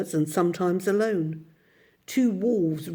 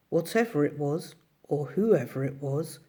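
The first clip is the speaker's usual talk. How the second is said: ordinary delivery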